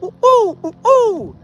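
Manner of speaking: high hooting ooh ooh call; fake bird call. Shown as a demonstration. Storyteller showing how the fake bird call sounded, high hooting. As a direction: sound like a person faking a bird. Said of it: "it sounded like me right now".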